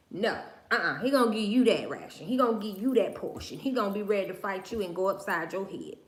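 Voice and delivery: ordinary talk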